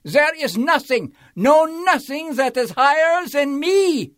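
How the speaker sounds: howling king. A narrator's reading. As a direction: howl him down in rage. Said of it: "howled the mighty King Yertle".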